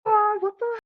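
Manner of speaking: very high retold shriek; mock scream. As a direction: imitate screaming into a diving regulator. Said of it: "then I started screaming".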